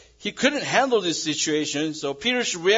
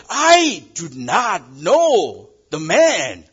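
slow, loud, deliberate denial; denial with an oath; 4 semitones higher, 10 dB louder, much slower than usual. Acted. Peter to the bystanders, a slow loud sworn denial. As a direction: swear it, every word weighed. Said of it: "with an oath".

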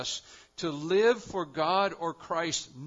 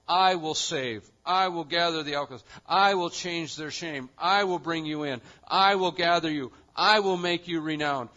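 "I" punched loud at the start of each line, falling; stress on I will; 5 dB louder than usual. Real, not acted. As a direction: hammer "I will" line after line, louder. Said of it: "contains the phrase, "I will".

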